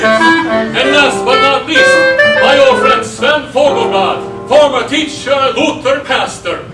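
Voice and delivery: big booming roll call; announcing the team. Acted. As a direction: present each man proudly to a crowd. Presents the debate team like announcer.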